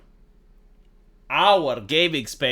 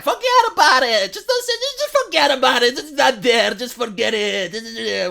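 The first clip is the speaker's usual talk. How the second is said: much higher and louder wave-off, slowing at the end; mock dismissive leftist; 6 semitones higher, 5 dB louder than usual. Vlogger mocking leftists who ignore things, high loud parody voice. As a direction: squeal forget about it as a parody. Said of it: "mock dismissal".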